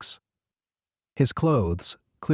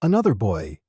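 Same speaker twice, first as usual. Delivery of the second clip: usual speaking voice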